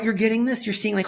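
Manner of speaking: ordinary delivery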